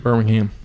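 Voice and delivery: Birmingham with a full HAM ending; Alabama pronunciation. Shown as a demonstration. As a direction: say Birmingham with the last syllable fully sounded as "ham". Echoes the Alabama city's name.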